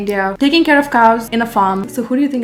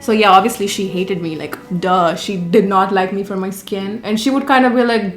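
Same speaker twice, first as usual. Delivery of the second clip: ordinary talk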